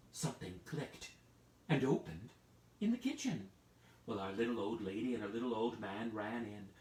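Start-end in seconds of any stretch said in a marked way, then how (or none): none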